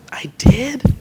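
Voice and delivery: high, whispered wonder. A narrator's reading. Boy amazed he made the world better, whispering. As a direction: ask it in amazed disbelief. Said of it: "Benny wondered".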